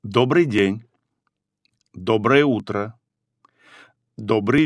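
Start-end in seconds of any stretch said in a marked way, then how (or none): none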